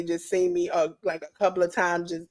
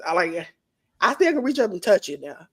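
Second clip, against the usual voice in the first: ordinary delivery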